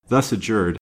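ordinary delivery, nothing marked

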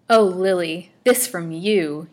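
loud, wounded reproach; hurt, emphatic cry; sorrowful reproach. A narrator's reading. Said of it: "he cried in sorrowful reproach".

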